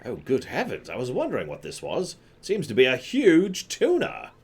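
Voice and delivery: British explorer with swooping high pitch; Colorado, astonished. Acted. Explorer wondering at the huge sea creature, theatrically astonished. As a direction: marvel in a mock British accent.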